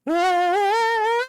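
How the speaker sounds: high shrill held creature cry; imitated Pokemon cry. Gamer showing the best Pokemon cry, high held shriek. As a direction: belt out the game's cry. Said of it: "That's the best cry".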